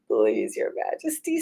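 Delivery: a touch of a timid fox. A narrator's reading. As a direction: beg humbly. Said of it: "said the fox".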